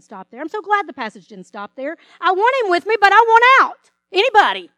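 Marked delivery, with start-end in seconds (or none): 2.2-4.7 louder, higher, pleading cry to get out; mock desperate plea. Believer crying out to God to escape trouble, loud and desperate. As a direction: beg loudly to be rescued out of it.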